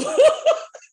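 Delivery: loud high laugh; high burst of laughter. Real, not acted. Laughing at her own Progressive ad joke, high burst. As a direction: break into a sudden laugh.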